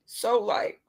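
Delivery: ordinary talk, nothing marked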